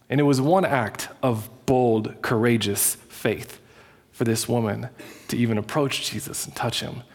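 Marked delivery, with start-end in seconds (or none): none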